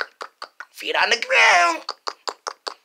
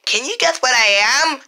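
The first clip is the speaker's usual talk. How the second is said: usual speaking voice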